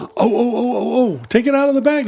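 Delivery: excited. Real, not acted. Excited reaction to the tie.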